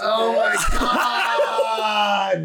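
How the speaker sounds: slow laughing high oh my God; laughing exclamation. Real, not acted. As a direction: crack up as it dawns on you. Realizes the joke, laughing.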